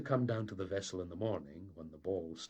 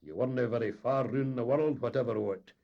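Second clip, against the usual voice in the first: raised, cutting retort; unkind jibe; 5 semitones higher, 8 dB louder than usual. A narrator's reading. Engineer jibing at the captain, cutting. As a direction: needle the captain. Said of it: "unkindly interjected the engineer".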